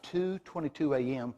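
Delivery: ordinary delivery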